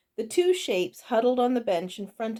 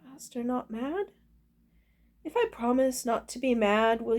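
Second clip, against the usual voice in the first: hesitant goblin; 4 semitones higher than usual. A narrator's reading. Cook checks whether master is angry, hesitant. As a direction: ask timidly, pitch rising. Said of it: "Orla looked up hesitantly".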